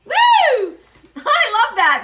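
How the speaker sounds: a high, loud burst of laughter into "I love that"; delighted laugh. Real, not acted. Reader thrilled by her bee card message, high delighted laugh. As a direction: laugh with pure joy at the message.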